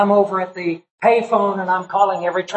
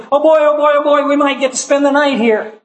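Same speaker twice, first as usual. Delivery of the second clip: put-on high, bright-eyed child's glee, a little louder; excited cheering; 7 semitones higher, 3 dB louder than usual. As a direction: cheer it like a thrilled little girl. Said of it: "cheering and excited and saying".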